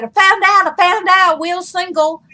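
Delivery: high, loud gleeful shout; excited shout. Retold or imitated. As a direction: announce it with glee.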